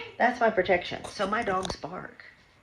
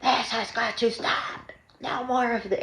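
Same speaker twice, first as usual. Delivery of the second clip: mild mock yell; 7 semitones higher than usual. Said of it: "he's like".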